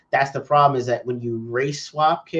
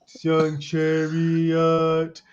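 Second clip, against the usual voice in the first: mock greeting; much slower than usual. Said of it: "I was like".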